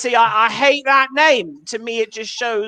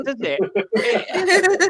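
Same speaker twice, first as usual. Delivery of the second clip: ordinary talk, nothing marked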